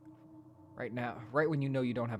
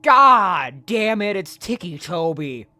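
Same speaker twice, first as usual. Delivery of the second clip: loud, slow, higher groaning outcry; exasperated shout; 9 semitones higher, 15 dB louder, much slower than usual. Real, not acted. Streamer spots a character they hate, slow exasperated shout. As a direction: drag out the name in exasperation.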